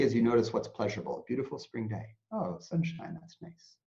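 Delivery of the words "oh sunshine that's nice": higher, then soft whispered contentment; gentle whispered delight in the sun; soft pleasure. Shown as a demonstration. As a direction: savor the sunshine quietly. Noticing pleasant things calmly.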